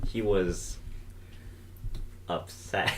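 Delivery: low, quiet UPSET after a long pause; odd dramatic pause. Real, not acted. Co-host describing Jake's reaction, oddly weighted pause before UPSET. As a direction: hold back, then drop UPSET low and soft. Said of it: "Why did you say it like that?".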